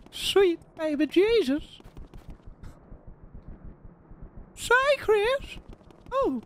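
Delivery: put-on creepy old man asking for the ice cream truck; Herbert voice. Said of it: "it's come back".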